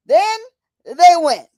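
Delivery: emphatic warning. Real, not acted. Warning the courts must not win.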